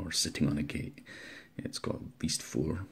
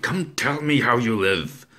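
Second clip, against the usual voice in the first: louder, higher, shouted demand; cried out; 7 semitones higher, 13 dB louder, slower than usual. Acted. Narrator crying out at the old man. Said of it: "I cried".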